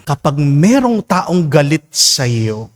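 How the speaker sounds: ordinary talk, nothing marked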